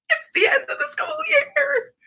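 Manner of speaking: high, crying-out glee at the year's end. Real, not acted. Teacher celebrating the school year ending, high cry.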